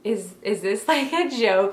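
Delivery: incredulous laugh through the words; amused disbelief, a laugh breaking in. Retold or imitated. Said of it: "being in disbelief".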